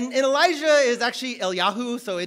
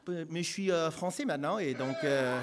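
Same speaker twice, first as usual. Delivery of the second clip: joking French; 7 semitones lower, 7 dB quieter than usual. Pretend.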